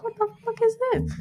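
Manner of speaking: high, fast squeal of shock; mock shriek at the screen; shocked disbelief. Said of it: "and I was like".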